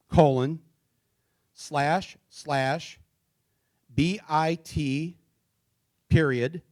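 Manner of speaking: very slow, piece-by-piece dictation; reading slowly. Shown as a demonstration. Speaker reads a web address to the audience, slow dictation. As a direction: read out each character separately, with long pauses. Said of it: "I'll read it slow".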